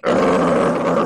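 deep drawn-out dog growl; imitated Great Dane snarl. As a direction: growl low like a big dog. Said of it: "he's like".

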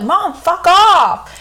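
high, drawn-out retort to mom; mock yell. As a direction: act out a cheeky, stretched-out outburst. Acts out defying mom on dare.

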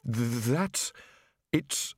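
breathless stammered fragments; gasping. Acted. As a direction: gasp out the words half-winded. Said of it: "Rincewind gasped".

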